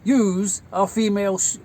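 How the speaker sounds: mock lecturing on grammar; correcting father. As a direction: imitate a dad explaining the word firmly. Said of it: "correcting me on grammar".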